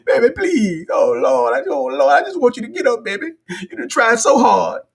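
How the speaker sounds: high, anguished mock plea; pleading parent. Wife to fighter like parent to fallen kid, pleading. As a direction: beg like a parent over a fallen child. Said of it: "you know how your kid be riding a bike".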